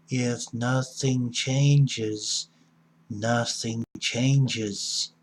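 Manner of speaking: very slow, each word drawn out; real slow. Shown as a demonstration. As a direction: spell it out slowly so it sinks in. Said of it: "I'm gonna say this real slow".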